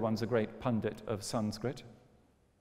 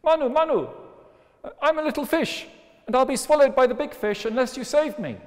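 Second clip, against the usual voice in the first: squeaky fish voice; 13 semitones higher, 7 dB louder than usual. Acted. Fish pleads with Manu.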